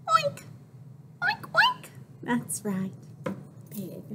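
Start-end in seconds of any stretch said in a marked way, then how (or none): 0.1-1.9 very high squealing oinks; pig oinks. Acted. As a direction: squeal like a piglet. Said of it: "what does a pig say".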